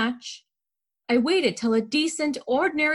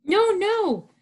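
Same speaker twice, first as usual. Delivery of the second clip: high-pitched, alarmed objection; raised pitch, urgent polite protest; 8 semitones higher than usual. A narrator's reading. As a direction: cut in anxiously to stop him. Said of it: "I protested again".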